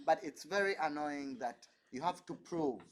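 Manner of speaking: ordinary delivery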